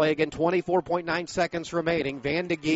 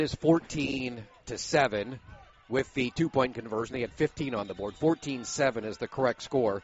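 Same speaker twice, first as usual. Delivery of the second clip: quiet aside; 7 semitones lower, 8 dB quieter than usual. Real, not acted. Quiet scoreboard correction aside.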